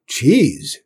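rustic cook ranting; loud, rough cook grumbling; rough country cook. Acted.

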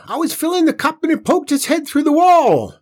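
high, loud panicked wail; terrified wail. A narrator's reading. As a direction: wail it out in terror. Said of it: "she wailed".

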